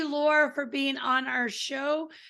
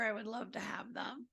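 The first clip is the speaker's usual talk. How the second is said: breathy unvoiced whisper; 2 semitones lower, 13 dB quieter than usual. Real, not acted. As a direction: let it fade to a whisper. Host trails off into a whisper.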